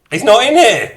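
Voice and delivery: loud, high-pitched real surprise; surprised outburst. Real, not acted. Streamer finding he's not in the room, surprised outburst.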